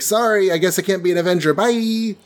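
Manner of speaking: high, quick kiss-off; breezy mock apology. Spider-Man lies to the Avengers and leaves, high breezy apology. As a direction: toss it off high and make an exit.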